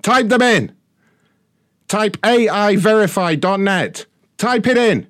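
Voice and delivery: furious repeated yelling; screaming. Real, not acted. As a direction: scream the order at her. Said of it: "you don't have to scream at me".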